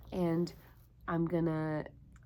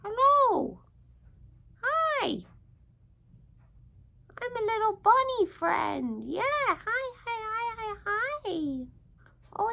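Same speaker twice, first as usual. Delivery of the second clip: very high, squeaky little bunny voice; bunny puppet voice; 12 semitones higher, 4 dB louder than usual. Acted. A teacher voices her bunny puppet greeting the children. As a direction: chirp it like a tiny eager rabbit puppet. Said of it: "here he is".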